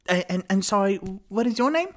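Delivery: high, loud bookseller voice; effusive shopkeeper. Acted. Bookseller asks a new customer's name, high effusive voice. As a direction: ask in a high, eager character voice.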